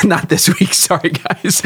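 giggling through the words; can't stop laughing. Real, not acted. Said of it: "You just can't say it without laughing".

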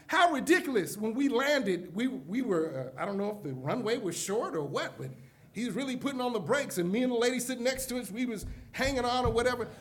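ordinary talk

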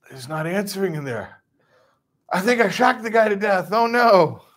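high, rising hammed-up panic; mock horror at a silent victim. Panicking subject fears killing man.

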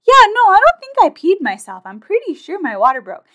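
high, loud, incredulous insistence; exasperated insisting. Retold or imitated. Telling her husband on the phone, loud high disbelief. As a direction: push back hard on his doubt. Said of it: "I'm like".

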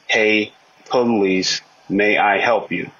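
"Hey, police, may I help you?" spelled out slowly, syllable by syllable; slow syllable count. Shown as a demonstration. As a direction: drag each syllable so it can be counted. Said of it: "That's seven syllables".

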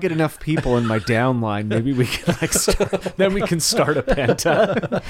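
hammed-up MLM recruiter, half laughing; mock MLM pitch. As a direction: play a giddy pyramid-scheme recruiter. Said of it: "You're like".

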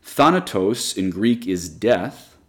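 Thanatos said slowly as THAH-na-tos; Greek word spelled out. Shown as a demonstration. Teacher offers one last Greek word, Thanatos, slow and careful. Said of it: "one more example".

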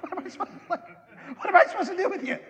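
high, laughing disbelief; laughing exasperation. Retold or imitated. Pastor laughs back at a listener calling it religious. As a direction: throw up your hands through a laugh.

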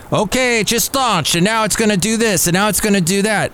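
excited, fast, high announcer patter; mock rocket-launch commentator; sports announcer. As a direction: rattle it off like a play-by-play man. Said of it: "sports announcer giving the play-by-play".

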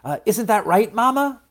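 high, rising question to Mama; uncertain appeal. A narrator's reading. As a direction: seek Mama's backing, pitch climbing. Seed checks with Mama.

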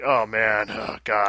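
low, weary groan as the dreading cast; resigned, dragging mock lament; imitated cast dread. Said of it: "they're probably like".

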